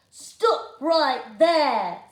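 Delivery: loud, very slow command; commanding stop. Acted. George stopping Charlene and Marlene, loud and very slow. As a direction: halt them like a guard.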